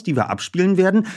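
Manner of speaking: ordinary talk, nothing marked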